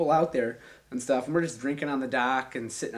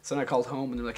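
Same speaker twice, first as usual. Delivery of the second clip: ordinary talk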